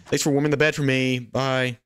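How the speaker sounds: high, mock-sweet farewell; cheeky thanks. Thief thanks villager for the bed, cheeky sweetness. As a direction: chirp it while making off with the loot.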